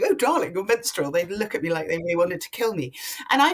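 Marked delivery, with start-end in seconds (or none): 0.0-1.1 mock doting mum. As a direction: coo it at the teenage daughters in a hammed-up way. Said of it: "But if I started going".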